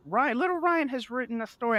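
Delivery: high singsong teacher announcement; high-pitched mock schoolteacher; imitated teacher. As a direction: mimic a teacher proudly presenting a pupil, pitched high. Teacher's announcement voiced in anecdote.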